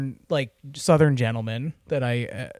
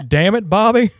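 loud, higher Texan cartoon-dad voice; Hank Hill; 7 semitones higher, 5 dB louder than usual. Shown as a demonstration. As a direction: impersonate an exasperated Texas cartoon dad. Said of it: "Hank Hill".

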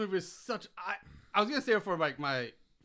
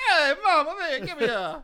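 loud, high mock Italian accent; 10 semitones higher, 8 dB louder than usual. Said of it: "And then he's Italian".

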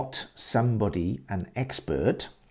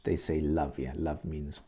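usual speaking voice

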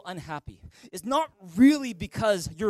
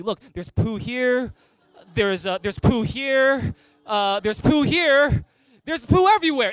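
building excitement; 4 semitones higher, 6 dB louder than usual. Real, not acted. Pointing out poo all over.

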